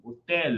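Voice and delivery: "Hotel" with a sounded final L; "Hotel" ending on a clear L. Shown as a demonstration. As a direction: make the L of "Hotel" heard at the end. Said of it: "Tem que pronunciar o L".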